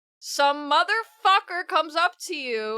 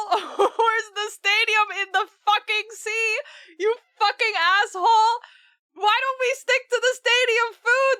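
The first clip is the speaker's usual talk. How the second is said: higher, louder, laughing fake fury; mock angry rant; 9 semitones higher, 5 dB louder than usual. Real, not acted. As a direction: rant at the game, half laughing. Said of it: "I really am angry today".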